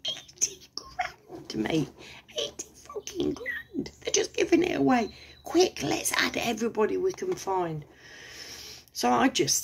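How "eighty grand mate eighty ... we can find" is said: breathless sarcastic whisper; a gleeful conspiratorial whisper; mock excited whisper. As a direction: pretend to be thrilled by the free money.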